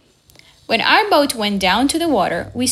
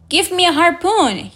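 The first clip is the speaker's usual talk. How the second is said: higher, angry demand for the harpoon; 7 semitones higher, 3 dB louder than usual. A narrator's reading. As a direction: snap the order with rising anger. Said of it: "he said angrily".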